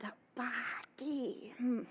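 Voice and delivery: hushed, slow whisper. Acted. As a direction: whisper it slyly. Nurse whispering about Romeo's body.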